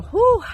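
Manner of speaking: high, loud exhaled whoop; sudden loud release of feeling; overwhelmed whew. Real, not acted. Overwhelmed, catching herself.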